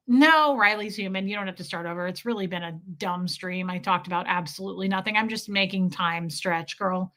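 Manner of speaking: ordinary talk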